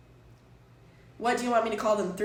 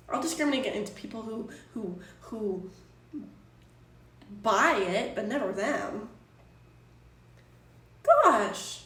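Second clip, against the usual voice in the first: ordinary delivery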